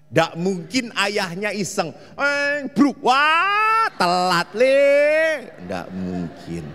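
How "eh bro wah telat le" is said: mock teasing father. Father mocking son, joking.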